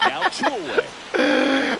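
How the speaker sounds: slow, high, giggly call; laughing. Real, not acted. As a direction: squeeze the words out through laughter. Commentator still laughing.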